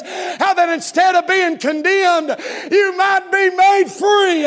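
loud, high, driving Southern-revival preacher cadence; high-pitched pulpit shout in rhythmic bursts; full-force preaching. Real, not acted. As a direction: preach it hard and loud, drawing out the big words.

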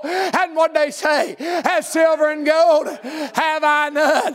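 driving revival sermon cadence; full-force preaching. Real, not acted. Preacher rallying church to spread good news, full-force preaching. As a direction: preach it hard, stretching the big phrases.

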